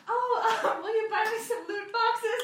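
put-on high, girlish wheedling with laughter; high-pitched imitation of a girlish persona; Millie the Mercy. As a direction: ask for a favor in a put-on girly voice. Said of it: "what Sam would do would be like".

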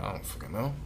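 usual speaking voice

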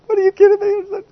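high, squeaky voice of disbelief; high, shocked voice for a caught sibling; startled disbelief. Retold or imitated. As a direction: squeak it out like you just got caught. Aaron and Miriam called before God.

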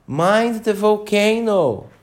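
high, slow warning call; a queen shouting a warning. A narrator's reading. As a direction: call a warning across the room.

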